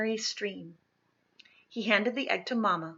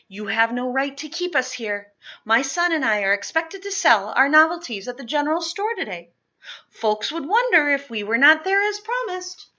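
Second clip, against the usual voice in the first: firm protest; 7 semitones higher, 9 dB louder than usual. Acted. Mother confronting slave catcher.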